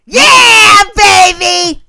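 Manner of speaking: high, loud triumphant yell; excited shout. Acted. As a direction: celebrate the deal loudly. Skit deal celebration.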